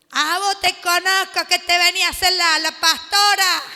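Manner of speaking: loud mocking demon voice; taunting demon. Possessed person taunting the pastor, loud and mocking. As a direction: sneer it loudly at the pastor.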